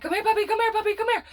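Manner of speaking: high coaxing puppy call; high voice luring a puppy. Retold or imitated. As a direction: coax the puppy to come, pitched high. Calling puppy again.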